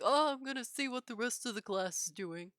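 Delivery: mocking imitation of a bumbling teacher. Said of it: "to bumble on over and be like".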